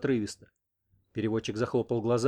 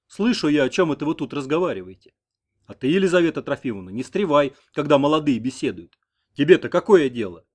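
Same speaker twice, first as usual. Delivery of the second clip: sober-voiced; 2 semitones higher, 7 dB louder than usual. A narrator's reading. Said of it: "совершенно трезвым голосом спросил".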